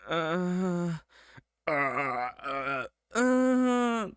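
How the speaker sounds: drawn-out hammed-up groans, rising high; mock patient moaning. Reader adding emergency ward ambiance, mock moaning and groaning. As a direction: groan along as background ambiance. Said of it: "just adding ambiance".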